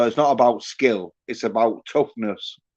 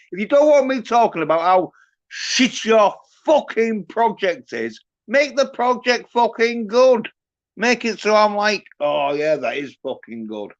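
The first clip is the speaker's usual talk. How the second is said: angry rant; 8 semitones higher than usual. Real, not acted. Ranting reply to the industry.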